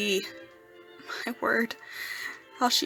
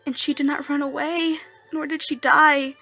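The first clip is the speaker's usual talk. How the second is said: loud, raised, triumphant wonder; overwhelmed amazement; 4 semitones higher, 9 dB louder than usual. Acted. Erik telling Daroga she stayed when unmasked, loudly amazed. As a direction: build to an astonished peak.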